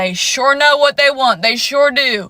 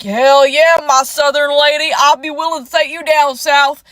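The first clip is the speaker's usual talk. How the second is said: put-on Southern accent; 3 dB louder than usual. American man flirting with a southern lady in the comments, mock Southern accent. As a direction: imitate a cocksure Southern man. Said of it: "everyone in my comment section has a southern accent".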